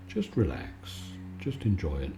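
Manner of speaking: soft and low, calming; soothing. Real, not acted. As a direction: lull the listener to sleep. Soothing the lying-down listener.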